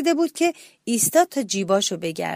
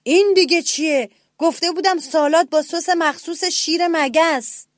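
loud, higher angry roar of a lion king; 7 semitones higher, 5 dB louder than usual. A narrator's reading. Lion roaring at the little mouse over the salad. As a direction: bellow it like an offended lion sultan. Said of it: "نعره کشید".

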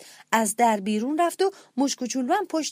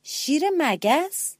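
slow, puzzled small-mouse question; drawn-out wondering rise; surprised; 6 semitones higher, much slower than usual. A narrator's reading. Little mouse asking the lion, surprised. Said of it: "با تعجب به شیر نگاه کرد و پرسید".

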